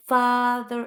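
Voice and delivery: "father" with a loud, stretched ah; long A sound. Shown as a demonstration. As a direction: draw out the first vowel of "father". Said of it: "that long A sound".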